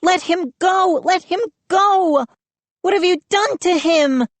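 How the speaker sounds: high frantic shouts; Mindy begs Moose to release Joe, frantic. A narrator's reading. High panicked pleading. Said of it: "Mindy shrieking continues".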